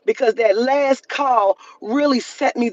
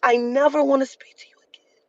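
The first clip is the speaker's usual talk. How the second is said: whispered threat; 2 semitones higher than usual. Real, not acted. Telling the rude employee she is done, in a whisper. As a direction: hush it like a final promise. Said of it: "I mean that".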